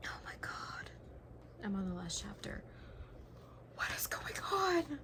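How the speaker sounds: hushed, breathless whisper; tense whisper. Real, not acted. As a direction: whisper it in suspense. Tense final chapter.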